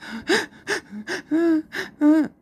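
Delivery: a loud, high sobbing gasp; teacher shows the class how a storyteller sobs with a gasp. Shown as a demonstration. Teacher demonstrates a storyteller's crying for the children, a loud high sob. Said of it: "vai fazer assim".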